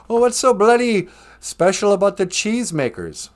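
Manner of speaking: high, indignant comic outburst; indignant film heckler. Retold or imitated. Retelling a Monty Python film to the audience, mock indignant.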